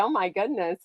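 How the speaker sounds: normal talking voice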